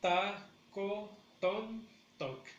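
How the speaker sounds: "Tacotontoc" as ta, co, ton, toc; syllable by syllable. Shown as a demonstration. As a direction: break "Tacotontoc" into four beats for learners. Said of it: "el acento lo tenemos en la tercera".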